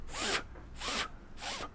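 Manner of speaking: unvoiced f f f hissed out; breathy f f f sound alone. Shown as a demonstration. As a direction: push air through the f with no voice. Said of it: "when you stress f".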